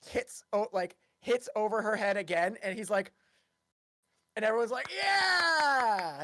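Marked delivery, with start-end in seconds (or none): 4.9-6.2 high, drawn-out cheering shout; long, loud whoop; crowd cheer. Acted. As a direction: cheer like a whole audience.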